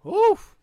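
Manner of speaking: loud high cringing oof; a big pained oof; exaggerated oof. Real, not acted. Reacting to walk of shame.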